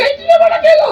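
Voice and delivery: shrill mock wonder at a giant banana; mock amazed squeal. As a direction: jump way up high in mock astonishment. Englishman marvels at big banana.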